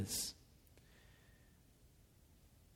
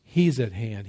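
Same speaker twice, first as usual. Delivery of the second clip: ordinary talk, nothing marked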